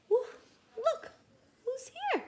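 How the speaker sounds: high, loud delighted call; very high thrilled exclamation; excited. Real, not acted. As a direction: point it out with glee. Teacher excited by picture.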